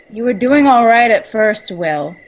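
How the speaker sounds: loud, teasing put-down; mocking Will for his prank; mock praise. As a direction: call him out with sarcasm. [name] mocks prankster.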